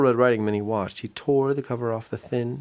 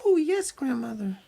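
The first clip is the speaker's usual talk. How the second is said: put-on much higher sweet girl; little girl voice; 12 semitones higher than usual. Minnie reassuring grandmother, high girl voice. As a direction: answer granny brightly.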